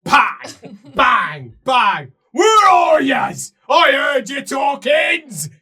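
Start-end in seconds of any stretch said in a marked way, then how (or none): 2.3-5.5 slow, high, loud ogre shout; dim ogre bellow. Acted. Ogre hunts for the party, slow bellowing. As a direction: boom it out, stupid and hungry. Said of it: "It says".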